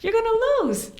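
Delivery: high, blunt know-it-all voice; mock blunt advisers. Advisers telling candidate she'll lose, mock blunt. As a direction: dismiss her chances bluntly. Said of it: "They're like".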